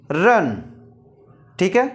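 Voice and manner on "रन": रन with U said as "uh"; separate, falling रन, vowel as "uh"; short A demo. Shown as a demonstration. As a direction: model रन carefully with the vowel अ. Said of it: "यू का उच्चारण अभी क्या आ रहा है".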